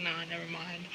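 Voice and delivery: low, quiet brush-off; quietly acted second thoughts; muttered retreat. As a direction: drop it low and soft, taking it back. Acts out their backing off.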